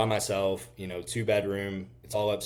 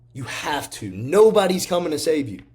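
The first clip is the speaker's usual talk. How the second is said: fired up; 8 semitones higher, 9 dB louder than usual. Real, not acted. Repeated motivational line.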